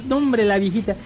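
ordinary delivery

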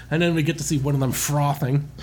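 usual speaking voice

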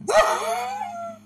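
a quick high giggle; high laugh. Real, not acted. Giggling high at the fake accent.

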